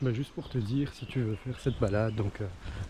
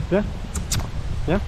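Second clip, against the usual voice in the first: calling out; 8 semitones higher, 6 dB louder than usual. Real, not acted. Calling sheep on hill.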